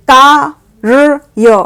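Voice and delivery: very slow, drawn out syllable by syllable; slowly sounding out कार्य; slow spelled-out reading. Shown as a demonstration. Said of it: "तो अब कार्य कौन सा सही होगा?".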